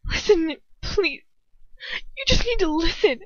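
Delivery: high, tearful begging; sobbing. A narrator's reading. Hermione begging Ron to hear her out, sobbing. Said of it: "torrent of sobs that burst out of me".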